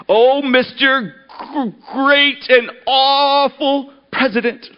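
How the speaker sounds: high, slow, crying plea; whimpering groveler. Acted. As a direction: beg with a sob in the voice. Man crawls in groveling.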